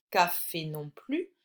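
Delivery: normal talking voice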